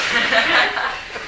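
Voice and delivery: loud, high burst of laughter; big laugh. Real, not acted. Teacher laughing at the Dalai Lama joke with audience. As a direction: crack up at the absurd image.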